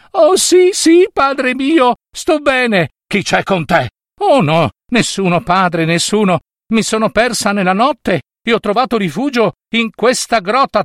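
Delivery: put-on high girlish voice, pleading innocence; high nymph voice. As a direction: lie sweetly in a high voice. Nymph answers her father.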